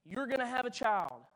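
a mock-grand voice from the sky; booming voice of God. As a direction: announce it like the heavens opening. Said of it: "God would speak down to us and say".

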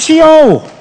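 put-on high, punched "she old"; comic high-voiced excuse; high-pitched blurt. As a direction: blurt it high, stretching the last word. Repeats the blunt excuse.

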